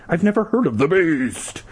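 theatrical emphasis on BEAST; hammed-up retake. A narrator's reading. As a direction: raise the pitch and stretch BEAST. Retake, hammed-up last word.

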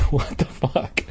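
whispering. Real, not acted. Laughing aside, breathy whisper.

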